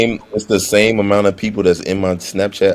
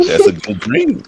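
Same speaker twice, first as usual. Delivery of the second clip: squeaky sweet falsetto, much higher; slower than usual. Pretend. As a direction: pipe it up like a delighted kid. Mocking the puppy dream.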